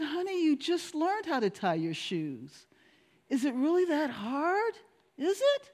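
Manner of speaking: high, soft, puzzled mom; puzzled mother. Retold or imitated. Mother gently questioning her crying son.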